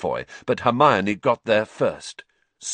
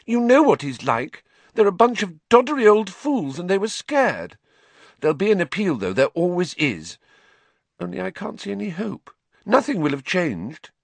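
tearful; 11 semitones higher than usual. A narrator's reading. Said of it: "wiping her eyes".

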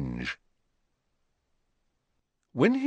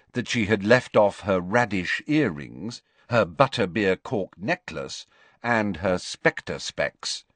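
ordinary delivery

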